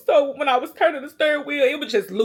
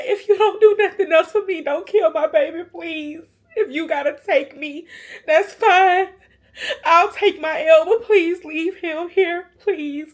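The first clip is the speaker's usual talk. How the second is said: high, choked-up plea to God; tearful pleading; 6 semitones higher, 4 dB louder than usual. Retold or imitated. Mother begging God to spare her baby, tearful pleading. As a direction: beg through tears, louder on key words.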